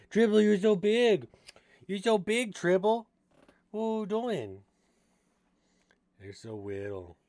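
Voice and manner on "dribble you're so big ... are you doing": pet talk. Real, not acted. Owner gushing at her pet rat Dribble in high loud baby talk.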